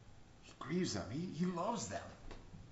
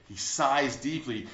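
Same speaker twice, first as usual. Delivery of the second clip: normal talking voice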